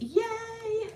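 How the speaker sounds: high, soft drawn-out yay; small self-celebrating squeal; little cheer. Real, not acted. Small cheer over finished cards.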